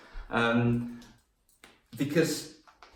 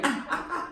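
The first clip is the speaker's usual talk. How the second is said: high, short mock wails; imitated crying yelps; 3 dB quieter than usual. Brother on an ant's nest, high mock cries. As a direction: imitate a little boy starting to cry. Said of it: "and started crying".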